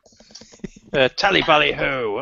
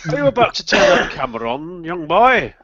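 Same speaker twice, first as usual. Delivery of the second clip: imitating Kev; 6 semitones higher, 4 dB louder than usual.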